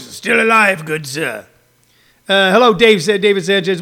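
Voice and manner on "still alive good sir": put-on British accent. Comic reading a viewer's comment, British voice. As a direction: read it as a British gent. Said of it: "in a British voice".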